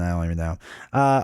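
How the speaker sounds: ordinary talk, nothing marked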